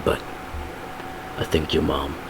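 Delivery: low, soft heartfelt talk; hushed, sombre confession; Butcher. Acted. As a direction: speak low and haltingly, with long pauses. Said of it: "Then Butcher and Ryan".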